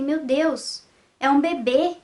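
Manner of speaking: ordinary delivery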